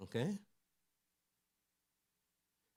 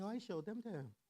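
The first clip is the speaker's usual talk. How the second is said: put-on higher, innocent child's voice; little girl; 9 semitones higher, 3 dB quieter than usual. Daughter innocently telling her daddy, higher child voice. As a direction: chirp it like a three-year-old.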